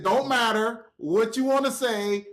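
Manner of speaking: slow, loud, emphatic shutdown; firm retold order, drawn out; stern refusal. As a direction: shut them down word by word. Bowman cuts him off, acted out.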